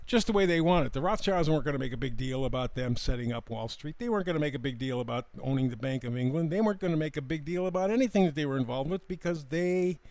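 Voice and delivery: ordinary delivery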